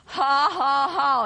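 deliberate stagey "ha ha ha"; mock divine laughter. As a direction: laugh it out in spaced, scornful syllables. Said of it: "is his response".

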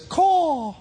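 high cawing crow, half laughing; high mock crow croaking woe. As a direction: caw like a gloomy crow. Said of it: "the other bird steps up and says".

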